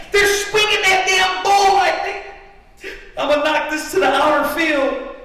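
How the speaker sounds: high-pitched, impassioned preacher shout; full-force preaching. Real, not acted.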